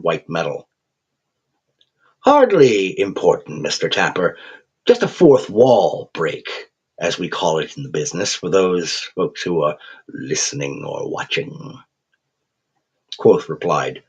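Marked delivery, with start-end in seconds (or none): 2.2-11.8 a cheerful English creature savouring every word; cheerful English accent. Acted. Raven creature chiding the thug in a cheerful English accent. Quoth's held voice.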